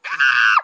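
loud delighted shriek; happy squeal. Pretend. [name] hugged by her boyfriend, loud happy squeal. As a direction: squeal of joy at the hug. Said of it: "But I didn't do that".

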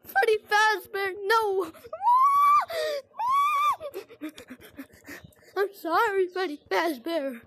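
whiny fake-sob pleading; half-crying, begging; mock crying plea. Real, not acted. Caught by Freddy in game.

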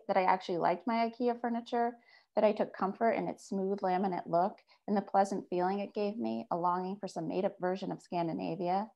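ordinary delivery, nothing marked

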